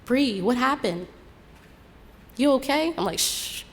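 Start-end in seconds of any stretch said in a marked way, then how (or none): 0.0-2.9 higher-pitched, anxious questioning; acted worried check-in; concerned friend. Worried friend in the car asks. 3.2-3.6 drawn-out shushing hiss; acted hush. Hushes friend in the car.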